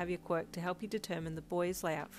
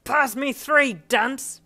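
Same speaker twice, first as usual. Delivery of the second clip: loud, impatient demand; yell; 7 semitones higher, 14 dB louder, much slower than usual. A narrator's reading. Bakugo interrupting Ida for snowballs, yelling. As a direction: yell over someone's instructions. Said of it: "Bakugo yelled".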